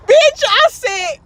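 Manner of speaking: loud, high, slow outraged yell; high shouted bitch; indignant shout. Retold or imitated. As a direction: shout it in disbelief. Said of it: "change my tone real quick".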